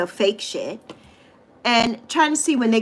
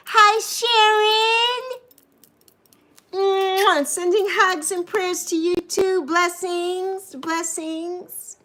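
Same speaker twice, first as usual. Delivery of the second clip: sweet greeting; 8 semitones higher, much slower than usual. Real, not acted. Greeting a viewer warmly.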